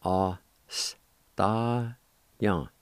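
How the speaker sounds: "A s ta yan" drawn out slowly; "A s ta yan" said very slowly and deliberately; slow careful pronunciation. Shown as a demonstration. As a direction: stretch out "A s ta yan" for learners. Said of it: "It's pronounced like this".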